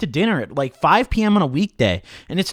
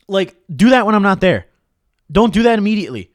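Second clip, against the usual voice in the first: exasperated outburst; 3 semitones higher, 7 dB louder than usual. Real, not acted. Ranting about dates checking channel.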